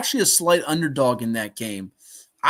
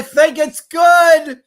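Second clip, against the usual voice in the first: high, drawn-out shout; loud, stretched, elated call; excited game call; 10 semitones higher, 11 dB louder, much slower than usual. Real, not acted. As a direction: shout the kick through. Calls field goal going through.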